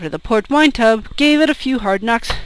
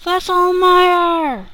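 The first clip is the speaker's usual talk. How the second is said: drawn-out shout; 7 semitones higher, much slower than usual. Acted. Drinker in the cellar calls his mate, long high shout. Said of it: "somebody shouted Fesselmeyer".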